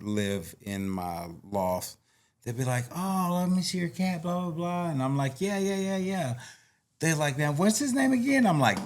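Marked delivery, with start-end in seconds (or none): 2.9-4.9 put-on high, lightly sketched kid voice; imitating pleading kids. Acting out kids asking about cat. 5.4-6.3 high, quick-fire agreement, retold; imitated indulgent reply. Acting out own reply to kids. 7.4-8.5 high-pitched mimicked kid question; imitating curious kids. Acting out kids asking cat's name.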